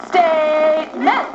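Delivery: slow, emphatic chant of STATEMENT; deliberate, stretched-out classroom chant; like you mean it. Shown as a demonstration. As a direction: draw STATEMENT out with conviction. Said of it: "say it like you mean it".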